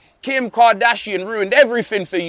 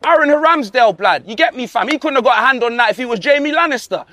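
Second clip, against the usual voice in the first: ordinary delivery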